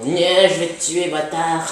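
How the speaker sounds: loud, drawn-out mock threat; mock monster threat at full volume; imitated menacing attacker. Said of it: "Il est en train de te penser".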